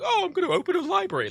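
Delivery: very high, quick silly voice; mock ceremonial mayor. Mimicking the Lord Mayor's trivial duties, silly high voice. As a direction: put on a piping, twittering dignitary.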